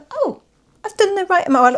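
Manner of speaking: high, delighted oh; pleased surprise. Real, not acted. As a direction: sound happily surprised. Counts stars, finds exactly five.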